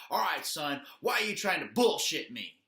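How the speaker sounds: hammed-up exasperated officer; imitated angry cop. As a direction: bark it like an officer calling out a kid's lie. Retold officer challenges the boy.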